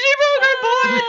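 loud, high mock-tearful cry; hammed-up happy wail; tearful moms. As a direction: imitate overjoyed moms bursting into tears. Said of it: "they had tears and they were all".

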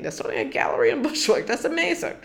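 high, quick delighted exclaim; thrilled self-quote. Retold or imitated. Hearing of a new gallery in Bushwick, delighted and high. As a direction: bubble over at the news.

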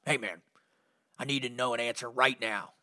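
lightly voiced friend asking straight out; voicing his buddy. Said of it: "It's like".

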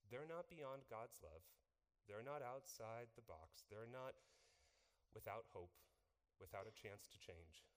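ordinary delivery

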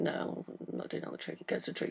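very soft, barely voiced quote; hushed imitation of an actress declining; retold refusal. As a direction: tuck the refusal away as a hushed aside.

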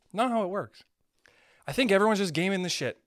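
usual speaking voice

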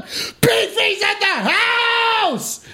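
very high, slow stretched announcement; announcer shout. Real, not acted. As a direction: announce him like a hype man. Announcing a friend's arrival.

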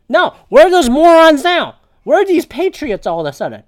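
loud, high-pitched challenge; indignant outburst. Real, not acted. Host calling out hypocritical patriots, indignant outburst. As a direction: demand an answer, raising pitch and volume.

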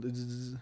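soft, low buzzing bursts; imitated drill buzz. Friend explaining how the tank is attached, imitated buzzing. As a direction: mimic a screw being driven in.

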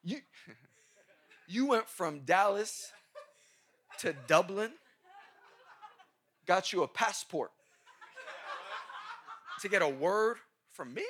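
laughs through the words; incredulous, chuckling; real laughter breaking in; laughing disbelief. Real, not acted. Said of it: "laughing disbelief".

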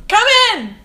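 loud high call through the door; real loud call to the door. Real, not acted. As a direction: shout to someone outside the door. Calling to knock on door.

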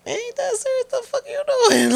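high, laughing mock disbelief; mocking incredulous guys. Retelling how the guys reacted to her squaring up, high laughing disbelief. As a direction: squeal it like they can't believe her, laughing.

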